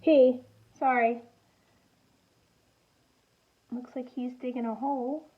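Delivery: ordinary delivery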